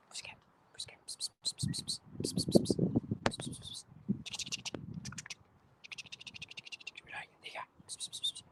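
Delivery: whispering psst to a cat; unvoiced whisper calling a cat. Real, not acted. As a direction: coax the cat with soft psst sounds.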